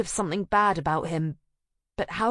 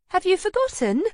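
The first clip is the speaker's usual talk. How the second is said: high, loud cheery friend voice; chirpy girlfriend calling up from the street; breezy best friend, pitched up; bright friend Anna; 14 semitones higher, 5 dB louder than usual. Acted. Anna laughing at her friend.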